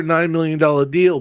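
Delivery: normal talking voice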